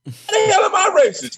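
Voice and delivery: high, loud laughing protest; laughing disbelief. Real, not acted. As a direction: laugh through the question in disbelief. Laughing at being called racist.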